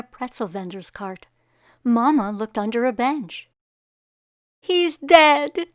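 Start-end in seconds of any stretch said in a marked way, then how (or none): 4.6-5.7 high, loud panicked cry; mother panicking over her son, high and loud; cried out. Acted. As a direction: cry out in sudden alarm. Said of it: "cried Mama".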